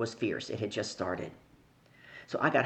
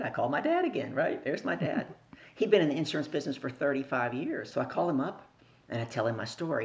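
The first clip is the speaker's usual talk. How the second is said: ordinary talk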